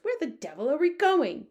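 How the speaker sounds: loud, high outburst; frantic cry. A narrator's reading. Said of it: "he cried".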